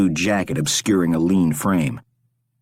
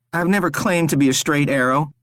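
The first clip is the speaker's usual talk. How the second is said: high, desperate begging; pleading captive Rory; 8 semitones higher than usual. A narrator's reading. Rory begs the stranger to spare him, desperate. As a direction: beg for your life, pitched up.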